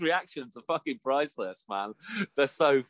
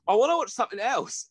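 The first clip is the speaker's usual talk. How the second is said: bored; 10 semitones higher, 3 dB louder than usual. Retold or imitated. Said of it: "I was just like".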